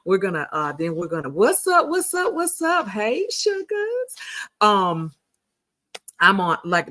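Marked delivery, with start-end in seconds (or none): 1.3-4.2 much higher, sing-song hello to viewers; excited greeting. Real, not acted. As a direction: greet the crowd up high and bright. Greeting the live stream audience.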